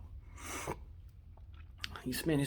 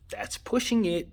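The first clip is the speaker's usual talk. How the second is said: much higher, incredulous second take on "That's pushing it."; raised, disbelieving "That's pushing it."; emphatic repeat; 9 semitones higher, 3 dB louder, slower than usual. Real, not acted. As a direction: lift the repeat up high, like you can't believe it.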